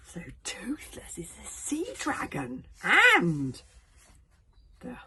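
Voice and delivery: excited, drawn-out astonishment; amazed reaction. Real, not acted. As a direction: marvel at the twist. Reader reacts to the revelation.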